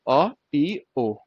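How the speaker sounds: ओ टी ओ read out slowly letter by letter; spelling it out. Shown as a demonstration. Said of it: "तो ये बन गया ओ टू".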